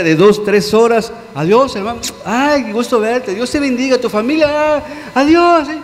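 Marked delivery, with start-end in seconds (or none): 1.3-5.8 voz aguda de saludo empalagoso, fingido; saludo falso de iglesia. Retold or imitated. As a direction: imitar al hermano que saluda con sonrisa falsa. Imita saludos superficiales en la iglesia. (saludo de iglesia exagerado y meloso)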